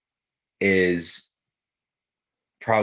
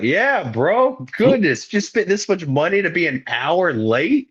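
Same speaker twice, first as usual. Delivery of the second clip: sarcastic mock; 9 semitones higher than usual. Mocking late arrivals' wasted money.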